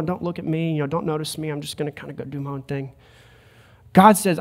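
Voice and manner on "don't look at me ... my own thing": softer, quick, shrinking mumble; timid mumble. Retold or imitated. Timid believer shrinking from notice, soft quick mumble. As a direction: hide away, small and shy. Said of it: "hang my head down low".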